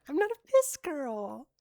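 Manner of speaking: high, loud outburst; sudden high-pitched denial; indignant objection. Real, not acted. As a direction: protest the teasing loudly. Objecting to a chat tease.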